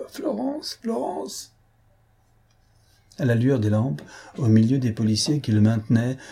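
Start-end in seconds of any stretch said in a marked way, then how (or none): 0.1-1.5 very high, soft, distant-sounding cry; desperate call. A narrator's reading. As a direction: call out her name in anguish, as if from afar. Desperate call to endangered woman.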